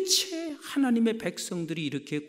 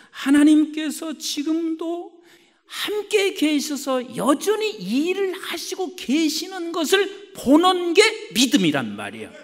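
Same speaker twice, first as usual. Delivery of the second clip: emphatic preaching; 9 semitones higher, 6 dB louder than usual. Acted. Preacher defines faith to the congregation, emphatic preaching. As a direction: stretch each phrase out with conviction.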